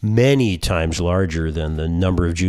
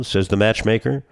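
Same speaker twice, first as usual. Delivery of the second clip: ordinary talk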